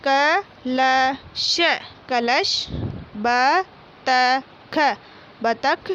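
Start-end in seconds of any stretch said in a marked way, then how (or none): 0.1-1.6 कलश drawn out very slowly as क-ल-श; slow syllable reading. Shown as a demonstration. Teacher reading कलश aloud for pupils, very slowly. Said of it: "मौखिक बोलकर पढ़िए". 3.2-5.0 बतख drawn out very slowly as ब-त-ख; slow syllable reading. Shown as a demonstration. Teacher reading बतख aloud for pupils, very slowly. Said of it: "मौखिक बोलकर पढ़िए".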